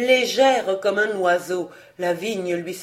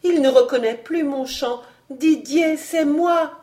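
higher-pitched plea with mild despair; discouraged; 7 semitones higher, 3 dB louder than usual. A narrator's reading. Girl calling Didier from the courtyard, discouraged. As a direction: call out to a beloved who cannot hear. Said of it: "disait la voix avec découragement".

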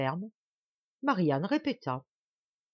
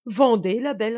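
loud, higher-pitched order; peremptory command; 6 semitones higher, 10 dB louder than usual. Acted. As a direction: bark it at her like a master. Duke curtly orders the girl. (ordre péremptoire, lancé fort)

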